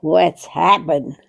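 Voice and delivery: ordinary talk, nothing marked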